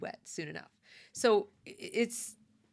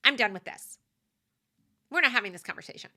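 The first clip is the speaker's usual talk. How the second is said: louder, clipped dismissal, speeding up at the end; curt shutdown; 3 semitones higher, 6 dB louder than usual. Retold or imitated. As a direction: shut the conversation down hard and fast. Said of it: "if you repeat things like".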